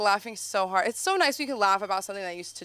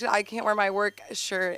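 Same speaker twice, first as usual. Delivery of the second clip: usual speaking voice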